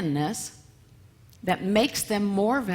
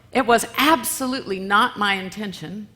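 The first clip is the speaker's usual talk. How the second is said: ordinary talk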